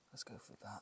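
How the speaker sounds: soft breathy whisper. Real, not acted. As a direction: breathe it out before the move. Before attempting move.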